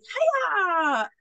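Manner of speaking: high, drawn-out hiya; mock riding cry. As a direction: imitate a giggly rider urging the horse. Retold woman urges horse on.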